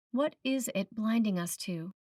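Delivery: ordinary talk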